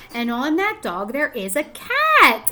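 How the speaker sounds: put-on gentle storybook read-aloud to young kids; reading to children. Reading picture book aloud.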